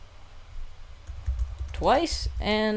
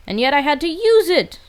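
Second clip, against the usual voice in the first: high, loud exasperated rant; frustrated yell; 11 semitones higher, 6 dB louder, faster than usual. Real, not acted. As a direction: vent it at the top of your voice. Frustrated outburst over wasted move.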